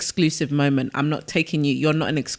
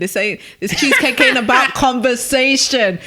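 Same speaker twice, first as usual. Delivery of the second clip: laughing through a mock defiant quote; quoting mum while cracking up; 8 semitones higher, 6 dB louder than usual. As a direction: let a laugh break mid-line, high and loud.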